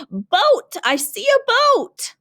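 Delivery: hammed-up shout of a stranded man spotting a boat; mock scream. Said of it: "He's screaming".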